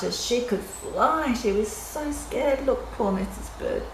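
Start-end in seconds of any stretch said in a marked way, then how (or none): none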